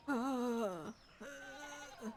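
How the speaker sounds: usual speaking voice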